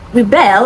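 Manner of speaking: "Rebel" stressed on the second syllable. Shown as a demonstration. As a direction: say "Rebel" as re-BEL. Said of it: "is stressed on the second syllable".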